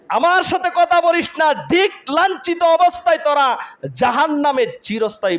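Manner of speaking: high, forceful preacher's outburst; stern rebuke. Acted. Allah forbids the damned to speak, high forceful rebuke. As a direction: dismiss them with full force. Voices God dismissing the damned.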